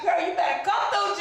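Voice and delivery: high excited shout, hyping herself up; girl-you-better-come-through hype shout; hyped shout. Retold or imitated. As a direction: cheer yourself on at the top of your voice.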